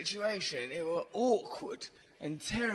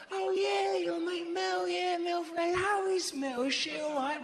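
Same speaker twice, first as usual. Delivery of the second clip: much higher, mock-keen gushing; high, hammed-up enthusiasm; over-eager reply; 10 semitones higher than usual. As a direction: gush about Mel, overdoing the interest. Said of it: "his over-eager reply".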